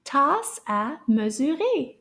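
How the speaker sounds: "Tasse à mesurer" with syllables joined in each word. Shown as a demonstration. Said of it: "say those a little bit faster".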